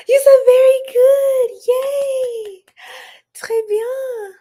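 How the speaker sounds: high, loud, thrilled squeal of praise; overjoyed squeal. Real, not acted. Praised for her French by a viewer, squeals with joy.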